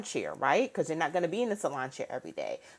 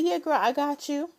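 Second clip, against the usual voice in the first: imagined supportive friend; 8 semitones higher than usual. Retold or imitated. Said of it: "No one comes and say".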